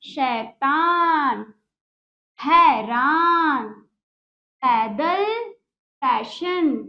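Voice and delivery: slow, drawn-out words; slow repeat-after-me. Shown as a demonstration. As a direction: say it slowly so kids can follow. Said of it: "बोलिए मेरे साथ".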